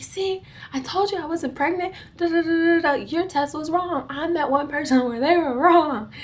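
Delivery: mock-triumphant retold protest; told-you-so. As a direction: rub it in like you've been proven right. Retold gloating protest to the nurse.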